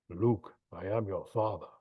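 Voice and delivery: low, slow menacing villain line; deep movie villain voice. Pretend. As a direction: intone it like a masked space villain. Famous villain line through mask.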